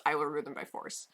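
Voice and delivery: low, soft deadpan exterminator line; a hint of a tough exterminator; gruff exterminator. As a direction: state it like a grim pro. Exterminator voiced in story.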